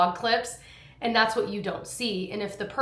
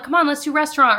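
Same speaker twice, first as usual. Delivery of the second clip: coaxing; 6 semitones higher, 5 dB louder, faster than usual. Retold or imitated. Coaxing kids to play, retold.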